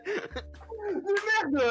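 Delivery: high, laughing curse. Real, not acted. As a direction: swear while laughing. Laughing at own mistake.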